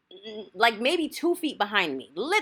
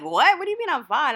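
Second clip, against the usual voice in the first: higher, indignant retort; indignant comeback; 6 semitones higher, 4 dB louder than usual. Retold or imitated. Retelling a reply to the man too close, indignant. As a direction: throw the question back, taken aback.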